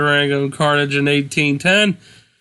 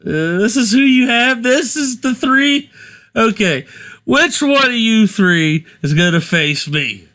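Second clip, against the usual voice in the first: lightly voiced arrogant wrestler, higher pitch; mock scornful challenger; 6 semitones higher than usual. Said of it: "He's like".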